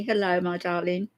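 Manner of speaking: normal talking voice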